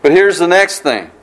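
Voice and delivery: ordinary delivery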